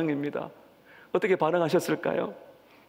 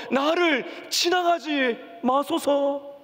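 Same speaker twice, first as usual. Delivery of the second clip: high, slow pleading cry; 8 semitones higher, much slower than usual. Acted. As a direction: stretch every word like a desperate beggar. Preacher acts Bartimaeus crying louder.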